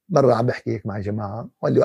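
ordinary talk, nothing marked

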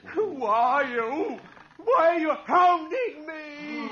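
slow, crying shout; frightened cry. Acted. Todd crying out at the ghost in fear. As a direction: cry out in terror. Terrified man confronting ghost.

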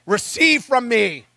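acting a self-assured prophet; bold man of God. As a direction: proclaim it grandly, slowing at the end. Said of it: "you see the boldness of the man of God".

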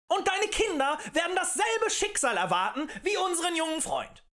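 high, loud orator's pitch; impassioned tavern agitator; rabble-rousing harangue. A narrator's reading. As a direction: stir up a crowd with indignation.

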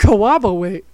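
"Corroborate" said like "co-wobo-wate", teasing; mock the slip on "Corroborate"; mocking mispronunciation. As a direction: mimic the fumbled "Corroborate" with mushy r's turned to w. Mimics the other's garbled word.

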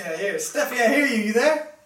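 usual speaking voice

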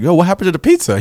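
high, loud mock alarm; alarmed question. Friend back from the bathroom finds pizza eaten, alarmed.

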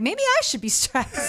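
small high worried voice; anxious kid. Retold or imitated. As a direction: wonder it like an anxious child. Child imitating anxious parent.

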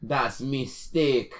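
very slow, hammed-up Jamaican accent; mock Jamaican accent. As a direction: draw out a fake Jamaican accent. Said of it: "how to do that accent".

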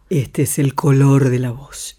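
deep, resonant chest voice; dark chest color. Shown as a demonstration. Voice teacher demonstrates vocal color, deep chest resonance. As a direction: drop low and let the chest ring. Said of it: "Si yo te hablo así, descoloco".

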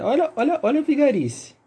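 excited build-up. Real, not acted. Teasing the co-host before his prank story, excited. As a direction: hype up the mischief coming. Announcing his childhood scheme.

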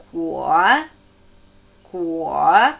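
Guo low and squatting, leisurely; leisurely dipping tone on Guo; low third tone. Shown as a demonstration. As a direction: let Guo sink low and take its time. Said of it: "Dritte hockende, gemächliche Ton".

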